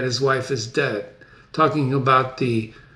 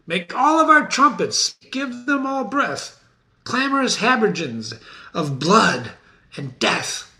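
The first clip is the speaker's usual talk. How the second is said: rousing Macduff; 8 semitones higher than usual. Acted. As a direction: call the trumpets to war. Macduff calling for trumpets.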